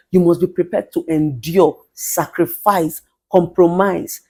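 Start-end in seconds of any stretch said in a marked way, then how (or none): none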